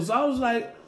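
ordinary talk

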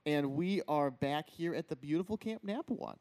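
normal talking voice

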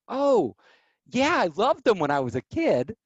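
mock eager reply; eager young self. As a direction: play your naive younger self. His young self answering eagerly.

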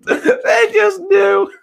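high, slow laugh-crying through the words. Real, not acted. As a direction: wheeze it out while laughing. Laughing at own story.

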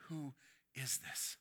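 low, soft, wondering question; awed murmur. Acted. Disciples whisper to each other about Jesus in awe. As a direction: ask it under your breath in awe. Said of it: "trying to understand".

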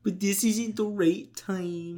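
slow, put-on voice of the girlfriend; mock girlfriend voice. Friend imitating girlfriend in Starbucks, slow put-on voice. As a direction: play the flustered girlfriend. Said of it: "She doesn't sound like that".

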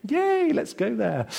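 high, slow mock enthusiasm; sarcastic cheer. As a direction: cheer it ironically, clearly not meaning it. Sarcastic cheer about bleak place.

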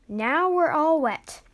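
a complaint dragged out high and loud; grumbling. A narrator's reading. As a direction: grumble it slowly and loudly. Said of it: "Ash grumbled".

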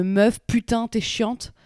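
very slow, every word laid out. Shown as a demonstration. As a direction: spell out putain, t'es chiante slowly for a foreigner. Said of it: "je la refais en mode plus lentement".

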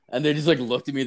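usual speaking voice

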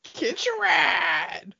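high, drawn-out shouted catchphrase; hammed-up, slow, high-pitched shout; Scooter. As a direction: holler it long and high like a game character. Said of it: "I love Scooter".

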